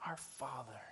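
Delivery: soft, hushed "Our Father"; hushed prayer. Shown as a demonstration. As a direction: say "Our Father" softly, like a whisper to God. Pastor softly modelling prayer.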